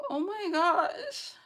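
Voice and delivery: slow, high and drawn out; teary oh my gosh. Retold or imitated. Teary sigh about a shrinking budget. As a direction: let it stretch out in disbelief. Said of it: "tearing up".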